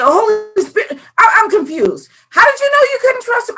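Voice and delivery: high-pitched mock confusion; squeaky, hurried bewilderment; baffled questioning. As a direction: press for an answer in acted disbelief.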